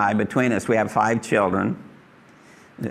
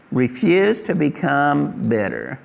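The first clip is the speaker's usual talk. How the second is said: slow repeated point; slower than usual. Shown as a demonstration. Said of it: "is refuse to become bitter".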